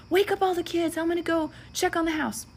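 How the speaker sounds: lightly voiced urgent husband. Husband telling the narrator to wake the kids, urgent.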